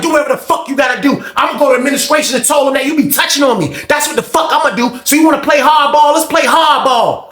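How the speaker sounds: high, defiant yell; imitating a high-pitched angry rant; retold yelling. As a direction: threaten to report him at the top of your voice. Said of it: "He's, he yelling".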